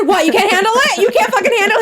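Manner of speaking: high-pitched combative retort; aggressive taunt. Retold or imitated. Maria fires back at Jesse on the phone, aggressive. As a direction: challenge him, pitch jumping way up. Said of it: "why you're so fucking aggressive".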